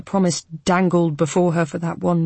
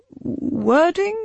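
puzzled; 11 semitones higher than usual. A narrator's reading. Lawyer puzzled by demand.

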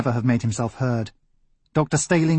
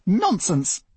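a touch of heat, pitched up; hot retort; 8 semitones higher than usual. Acted. Master Isbister brushes off the idea, mildly heated. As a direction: dismiss it with mild indignation. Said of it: "said Master Isbister hotly".

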